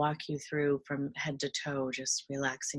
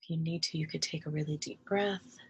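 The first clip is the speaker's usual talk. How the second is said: calm meditation guide with long pauses; guided meditation. Real, not acted. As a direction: ease them into relaxation, leaving room to breathe.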